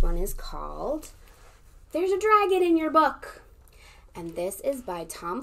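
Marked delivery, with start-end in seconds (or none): 1.9-3.4 high, loud title reveal; excited announcement. Acted.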